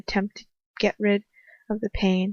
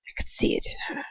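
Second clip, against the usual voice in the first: whispered, higher, relishing; gloating whisper; 7 semitones higher, 6 dB quieter than usual. A narrator's reading. Villain recalls choosing his victim in a gloating whisper. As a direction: hiss it with delight.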